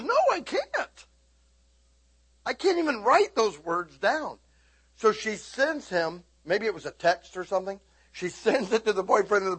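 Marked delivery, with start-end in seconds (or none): 0.0-4.4 high, slow, prim "No, I can't"; mock prim refusal. Daughter primly refusing to write the cuss words. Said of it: "She goes".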